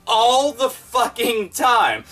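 slow, each word of All the fucking time hit hard; emphatic repeat. Real, not acted. Vlogger ranting to viewers, slow emphatic All the fucking time. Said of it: "all the time".

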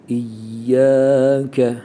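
İyyâke with a held, doubled y. Shown as a demonstration. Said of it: "şiddet harfi".

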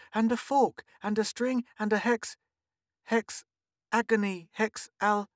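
put-on high-pitched little girl listing items; child dictating. A narrator's reading. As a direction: list it item by item, high and halting. Child dictating a list to friend.

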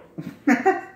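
high burst of laughter. Real, not acted. Cook laughing after food breaks, high chuckle.

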